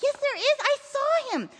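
put-on high, loud child's protest; insistent boy. A narrator's reading. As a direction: cry out desperate to be believed.

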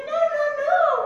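loud, high, hammed-up refusal; Peter. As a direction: cut in with a comic panicked objection. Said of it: "Peter's like".

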